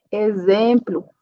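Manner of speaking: ordinary talk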